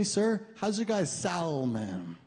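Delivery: slow, laughing, "salmon" with an audible L; the date asking the waiter, with the L in "salmon" said. Retold or imitated. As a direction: lean on the L in "salmon". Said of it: "pronounce the L in salmon".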